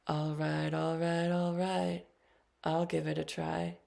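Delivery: bad Southern drawl, lightly done; a light, self-mocking attempt at a Southern accent; Matthew McConaughey. Poet imitating the star from a sleep app, light bad Southern accent. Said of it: "please forgive my bad Southern accent".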